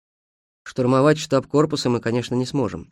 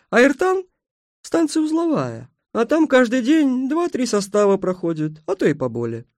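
obliging station mistress; 9 semitones higher than usual. Acted. Station mistress gives armed travellers directions, high and obliging. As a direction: voice a helpful countrywoman, pitched well up.

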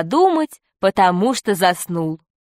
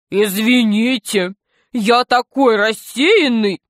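slow, apologetic lecturer voice; absent-minded lecturer. Acted. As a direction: apologise slowly, drawing out the words. Доцент извиняется. (медленно, виновато, голосом рассеянного доцента)

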